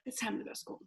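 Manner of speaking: soft, worn-out announcement; defeated mumble. Retold or imitated. Exhausted mom telling the kids it's time for school, soft and defeated. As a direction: say it drained and beaten. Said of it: "I was like".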